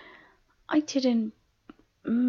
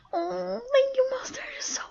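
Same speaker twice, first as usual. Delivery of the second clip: high, squealed gratitude; gushing, high-pitched thrill; delighted thanks; 13 semitones higher, 3 dB louder than usual. Acted. Delighted thanks for promised dinner.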